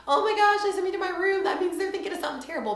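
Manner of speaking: put-on high, loud, fast childlike panic; kid panic. Mom recalls being sent to her room, high panicked kid voice. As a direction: play your younger self sure she's doomed. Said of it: "as a kid, you're like".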